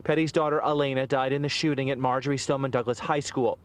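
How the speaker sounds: ordinary talk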